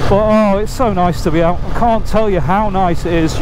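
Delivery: normal talking voice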